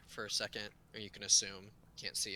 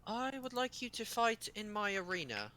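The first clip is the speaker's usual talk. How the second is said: put-on much higher, small child king giving an order; a tiny boy king making a demand; 11 semitones higher than usual. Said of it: "voice of a small child".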